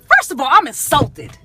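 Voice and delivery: high, loud, hammed-up outrage; retold indignant tirade. Customer scolding the restaurant manager over the burrito, hammed-up indignation. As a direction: tear into them, stretching the key words for effect.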